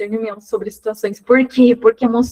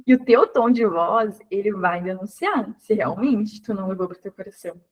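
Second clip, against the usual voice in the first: ordinary talk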